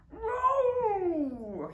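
high, loud drawn-out yowl; a stuck cat yowling. A narrator's reading. Stuck cat yowls down from the tree, high and loud. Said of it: "he yowled".

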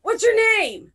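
high, carrying question; calling out. Acted. Party member asks a distant stranger's name, calling out. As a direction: call across a wide distance.

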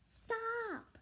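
high, drawn-out shout to stop; crying out. Acted. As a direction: yelp out in alarm. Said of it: "Jason cried".

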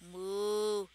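a lightly voiced cow's moo; mock moo. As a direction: call out like a cow. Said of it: "I'll call out for them".

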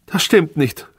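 high-pitched indignant call; crying out. A narrator's reading. Wife denying husband's accusation, crying out high. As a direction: call out the denial, high and sharp. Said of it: "rief sie".

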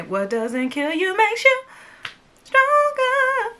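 slow build, then stronger shoots high and loud; soaring on stronger. Acted. Vlogger reciting the old saying, stronger pitched high and loud. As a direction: voice jumps way up on stronger.

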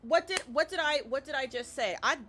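worked-up, stammering exasperation; exasperated. Real, not acted. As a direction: push back at being asked what you just answered. Annoyed at being asked for code.

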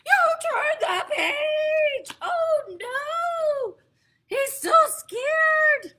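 high, loud, slow wail; loud, high distressed yell; panicked shout. Acted. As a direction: cry out in horror. Grover panics at page turn.

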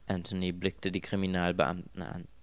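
ordinary delivery

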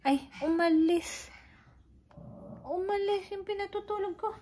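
shooing someone away. Real, not acted. Mother shooing someone away from the sleeping dog, loud. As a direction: chase them off loudly.

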